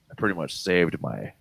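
low, soft mock nonchalance; imitated smug child. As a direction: drop it casually, like a humble brag. Daughter proudly reporting, in retelling.

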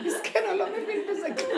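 real laughter while pitying him; laughing. Real, not acted. Laughs at the poor husband.

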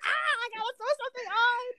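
high-pitched playful shriek into words; mock panic. Caught unready by a guy's video call, mock panic. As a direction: squeal it like a hammed-up scramble. Acts out scrambling when caught.

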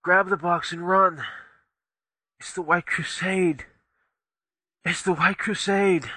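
shouted high and loud in alarm; urgent shout. Acted. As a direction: yell the warning to everyone. Warning shout in a fictional scene.

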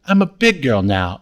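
usual speaking voice

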